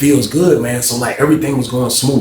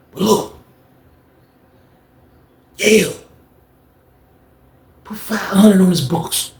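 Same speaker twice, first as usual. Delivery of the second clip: higher-pitched imitation of a phone conversation; halting one-sided call, pitched up; man on secret call; 7 semitones higher than usual. Leroy talking to his wife on the phone, high-pitched imitation. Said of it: "He like this".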